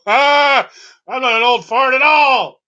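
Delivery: high, loud indignant yell; hammed-up offended old man; mock outraged shout.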